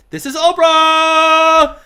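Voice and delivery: high, slow, loud shout of her own name; Oprah shouting. Retold or imitated. Oprah shouting on the phone at the owner who hung up.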